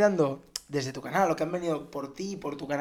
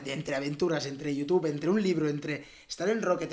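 ordinary delivery

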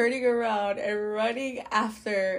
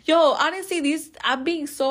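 excited; 7 semitones higher, 6 dB louder than usual. Real, not acted. Emphatic aside about honesty.

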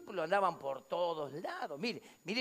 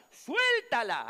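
loud, raised, stretched-out order; shouted command; 4 semitones higher, 6 dB louder, much slower than usual. Retold or imitated. Onlooker tells them to release the woman, shouted command. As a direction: order it forcefully. Acted command during the commotion.

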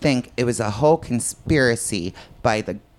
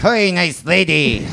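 Jerry Lewis voice; 9 semitones higher, 5 dB louder, slower than usual. Retold or imitated. Jerry Lewis style joke voice.

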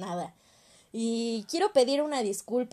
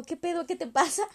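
higher mock-baffled guy at a party; retold alarmed reaction; 7 semitones higher than usual. Dance partner reacting to her sudden crying, mock alarm. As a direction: imitate a startled dance partner, pitched up. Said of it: "ese güey así como".

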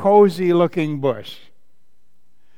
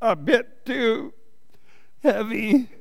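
grunted; 6 semitones higher, 4 dB quieter than usual. A narrator's reading. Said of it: "grunted Douglas".

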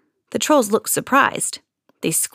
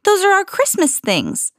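indignant protest; 11 semitones higher than usual. A narrator's reading. Protesting to the trolls.

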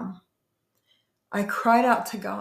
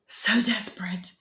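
breathless whisper, barely voiced; a hushed, spent whisper of despair; whispered echo. Real, not acted. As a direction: let the words fall away into a whisper. Whispered echo of own words.

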